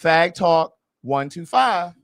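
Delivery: slow, low announcer intro; show intro. Acted. Host opening the podcast, slow announcer intro. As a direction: announce the show title slowly.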